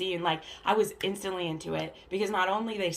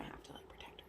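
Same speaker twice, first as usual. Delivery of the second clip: hushed whisper; whispered teaser, no voice at all; 21 dB quieter, faster than usual. Real, not acted. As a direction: breathe it like a secret.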